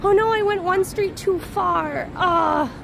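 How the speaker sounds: ordinary delivery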